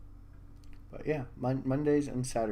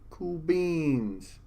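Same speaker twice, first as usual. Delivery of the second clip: ordinary talk, nothing marked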